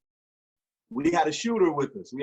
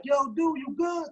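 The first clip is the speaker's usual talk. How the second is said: friend calling out; 12 semitones higher than usual. Retold or imitated. Friend with gun calls out.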